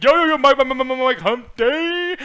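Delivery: loud, high, excited camel calling out; commercial camel impression. Host imitates the hump day ad camel, loud excited impression. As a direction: pester Mike with repeated calls, stretching the last words. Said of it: "That fucking camel's hilarious".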